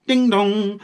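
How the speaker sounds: high sung ding dong like a doorbell. Acted. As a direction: chime it out like a bell. Doorbell imitated.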